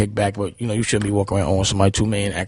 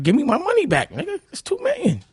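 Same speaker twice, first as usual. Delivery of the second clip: pitched way up, heated and insistent; retold angry demand. To the man who owes him, a high, heated demand. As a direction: demand it back like you're fed up.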